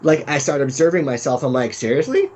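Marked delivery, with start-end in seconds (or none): 1.7-2.3 high, exasperated seriously; incredulous self-scold. Retold or imitated. Speaker mocking her own checkout panic, high and incredulous. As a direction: roll your eyes at yourself.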